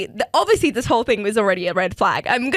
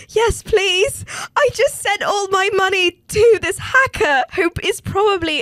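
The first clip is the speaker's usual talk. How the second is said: high, loud and laughing through the words; laughing relief; 9 semitones higher, 4 dB louder, slower than usual. Retold or imitated. Laughing as she admits to Devin she sent her money away. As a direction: giggle out the confession.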